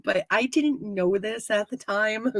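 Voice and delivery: normal talking voice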